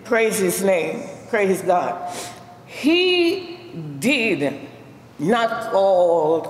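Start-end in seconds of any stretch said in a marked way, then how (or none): none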